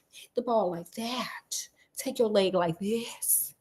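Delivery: whispered, slow instructions; whispered coaching. Retold or imitated. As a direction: hush it out like a coach leaning in, slowly. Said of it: "the one who was like".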